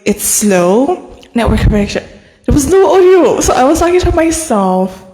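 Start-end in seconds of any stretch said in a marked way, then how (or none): 2.4-3.4 high, loud, laughing no audio; laughing at a live with no audio; laughing in disbelief. Real, not acted. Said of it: "Do you know why I'm laughing?". 3.4-5.0 high, amused, incredulous; half-laughing at yourself; laughing at twenty-five minutes of talking to herself; laughing disbelief. Real, not acted. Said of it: "Do you know why I'm laughing?".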